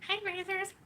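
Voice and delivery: very high put-on greeting; exaggerated high-pitched caricature; mocking impersonation. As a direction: squeal out a mock streamer hello. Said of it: "I have to do it".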